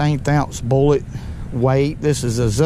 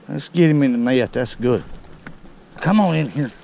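ordinary talk

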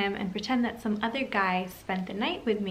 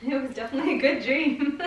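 higher, louder, breaking into laughter; laughing tease; 6 semitones higher, 4 dB louder than usual. Pretend. Prankster hinting at a good dream, laughing. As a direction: say it teasingly and end in a laugh. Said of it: "why you laughing though".

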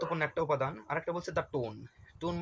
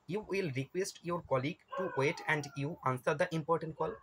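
slow option read; much slower than usual. Shown as a demonstration. Reading exam option aloud.

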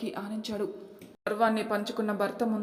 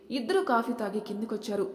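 usual speaking voice

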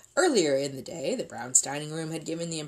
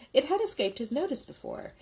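usual speaking voice

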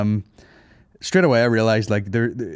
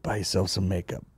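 Jack Nicholson impression; 3 semitones lower, 5 dB quieter than usual. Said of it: "That's my Jack Nicholson impression".

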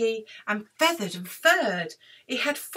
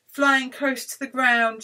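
plain reading voice

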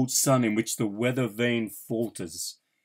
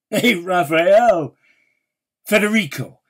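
calling out; 6 semitones higher, 4 dB louder than usual. Acted. Poet hailing his lost friends by name, calling out. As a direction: call the names out high across a street.

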